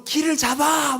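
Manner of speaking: high, strained mock shout; urgent calling out. Disciple to crewmates in the storm, acted high-pitched yell. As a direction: cry out an order over the storm without full volume.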